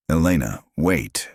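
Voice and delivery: plain reading voice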